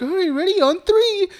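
very high, playful clown voice; Pennywise. Retold or imitated. Host retells the clown readying the girl, high and playful. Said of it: "then he's like".

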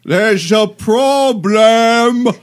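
put-on high, slow, shaky old man voice; quavering elderly man, drawn out. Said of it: "Yes, the old man voice".

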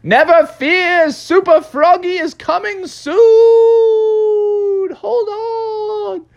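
high, loud superhero call; long, high-pitched rescue shout; heroic shouting. Acted. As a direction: holler reassurance across the distance, drawing out the ends. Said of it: "Froggy was shouting out".

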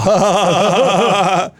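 mock laughing fit of a surfer bro; imitated dude laugh. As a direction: laugh like a douchey prankster. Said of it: "Och sen bara".

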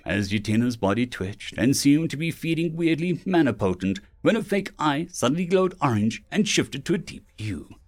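usual speaking voice

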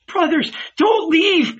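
high-pitched pleading cry; alarmed cry. A narrator's reading. Mary begging the fleeing men, high alarmed cry. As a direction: cry out to stop them going. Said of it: "Mary cried".

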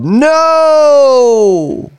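high, loud retold crowd yell. Bar crowd refusing a Bud Light offer, loud yell. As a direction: belt out the refusal like a whole bar. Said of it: "they yelled".

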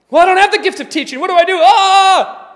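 hammed-up panic ending in a cry; mock panic. Preacher lacking the teaching gift, hammed-up panic.

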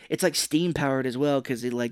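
ordinary talk

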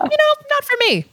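squeaky high quote voice; high mock voice. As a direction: pitch it way up in a jokey quote. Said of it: "I was like".

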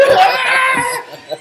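loud, high burst of laughter; high cackling laugh. Real, not acted. After the biker's punchline, a high loud laugh. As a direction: let it crack into laughter.

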